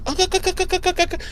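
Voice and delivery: high, clipped mock battle grunts; Xena war cry. Buffy fighting like Xena, high mock battle grunts. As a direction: yelp it like Xena charging in. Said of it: "like Xena".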